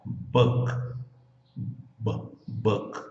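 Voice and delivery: Book closed on a soft K; consonant ending. Shown as a demonstration. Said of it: "como você está terminando o som".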